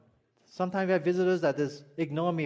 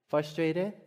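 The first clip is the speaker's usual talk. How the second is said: ordinary talk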